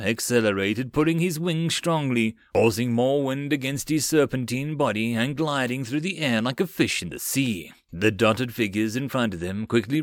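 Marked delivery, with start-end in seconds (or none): none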